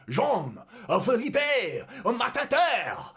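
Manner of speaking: loud, high, hammed-up French accent; mock French accent. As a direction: belt out the name in an exaggerated French accent. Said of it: "that's the best French accent".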